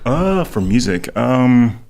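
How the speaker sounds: talking around a cough drop; mouth full. Real, not acted. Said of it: "sorry for chewing in the".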